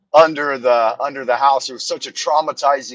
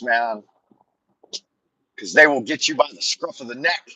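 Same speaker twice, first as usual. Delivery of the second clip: ordinary delivery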